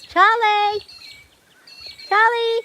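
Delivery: loud high call across the yard. Real, not acted. Calling out for chicken.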